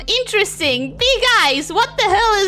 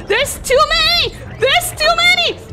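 mounting panic; 7 semitones higher than usual. Real, not acted. Gamer overwhelmed by enemies, mounting panic. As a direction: get more frantic with each line.

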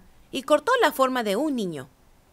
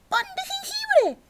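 a much higher squeal; high squealing cry. A narrator's reading. Javelinas spotting the cookie, high squeal. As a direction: squeal it like hungry pigs. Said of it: "gritaron las jabalinas".

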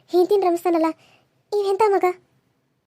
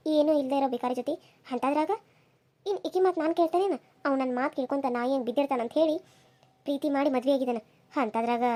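low, soft muttered scheming; plotting quietly to yourself; scheming aside; 5 semitones lower, 8 dB quieter than usual. Acted. Wife's scheming aside to herself. (ಸೊಸೆ ತನಗೆ ತಾನೇ ಕುತಂತ್ರದ ಪಿಸು ಮಾತು)

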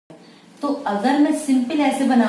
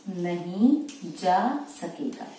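slow dictation; 3 semitones lower, 3 dB quieter, slower than usual. Shown as a demonstration. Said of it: "तो देखिए".

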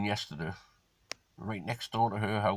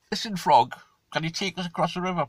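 higher, louder coaxing scorpion voice; scorpion asking; 7 semitones higher, 4 dB louder than usual. Acted. Scorpion coaxing the frog for a ride, higher and louder. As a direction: sweet-talk the frog, pitched up.

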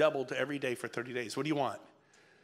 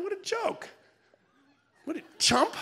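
high, scornful scoff; 3 dB louder than usual. Real, not acted. Speaker mocking a tiny commission, high scoff. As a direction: sneer at the deal.

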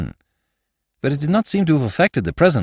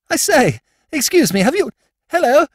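very high, louder toff voice; young lord calling; 4 dB louder than usual. Acted. Young lord calling out to Jimmy on the embankment, high louder toff voice. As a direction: hail him in a high excited aristocrat voice.

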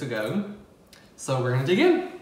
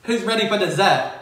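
put-on failed Australian accent; 7 semitones higher, 7 dB louder than usual. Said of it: "that was a fail".

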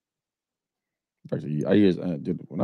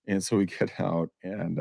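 ordinary talk

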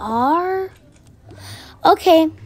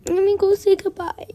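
ordinary talk

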